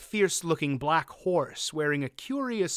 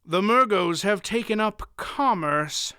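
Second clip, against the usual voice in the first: frowning; 6 semitones higher, 4 dB louder than usual. A narrator's reading.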